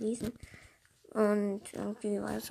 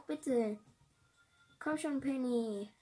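usual speaking voice

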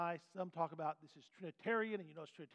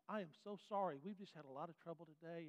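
softer, apologetic clerk; kind and sweet; 8 dB quieter than usual. Acted. Checkout clerk soothing a frustrated customer, soft and sweet. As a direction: gently say sorry to a grumpy customer. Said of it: "if she's being kind and sweet".

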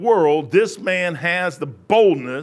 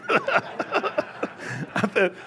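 ordinary delivery

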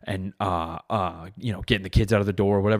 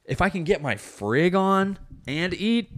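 slow, higher, savoring; lustful; 9 semitones higher, much slower than usual. Acted. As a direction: draw it out with relish. Lustful bit, slow high.

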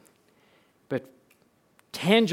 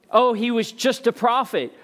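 higher-pitched voicing of a doubter; dismissive mock quote; 7 semitones higher, 4 dB louder than usual. Preacher quoting a false claim about Jesus, dismissively. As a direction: toss out the claim in a raised, mimicking pitch.

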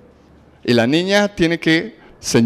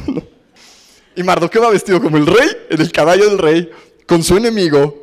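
ordinary talk, nothing marked

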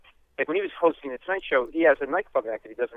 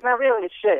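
Leno impression; 9 semitones higher, 5 dB louder than usual. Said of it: "He goes".

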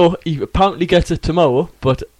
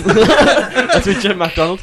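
Jim McDonald impression; 4 semitones higher, much slower than usual. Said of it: "the greatest impression of Jim McDonald".

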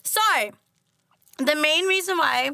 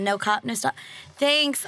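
normal talking voice

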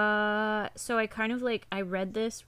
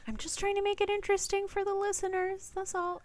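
high squeak; 14 semitones higher, 3 dB louder than usual. Acted. Defends herself in a squeaky voice.

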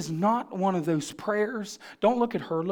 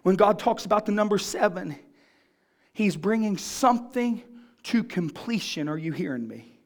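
usual speaking voice

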